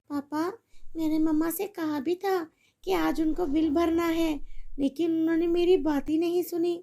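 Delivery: put-on a little girl begging her mother for help; pleading little girl. Daughter explains to father.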